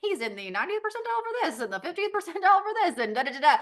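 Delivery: high-pitched, rapid-fire imitation of a chatbot; lightly voiced speedy computer reply; chatbot answering. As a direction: rattle it off like an instant readout. Acts out ChatGPT's instant answer.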